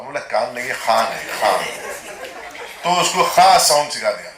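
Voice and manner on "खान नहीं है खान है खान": खान with a throaty kh from the back of the throat; deep kh in Khan. Retold or imitated. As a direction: say खान with a rasping, guttural ख. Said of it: "Imran खान बोला". (इमरान खान की नकल, गले से ख़ान बोलते हुए)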